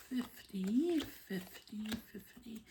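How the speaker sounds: counting coins in a hushed whisper. Real, not acted. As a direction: breathe the numbers out softly. Counting money under breath.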